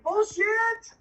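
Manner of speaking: high, incredulous scoff; scoffing disbelief. Retold or imitated. Newcomer doubting the leader's sobriety, incredulous. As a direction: call it out in disbelief. Disbelief at thirty years sober.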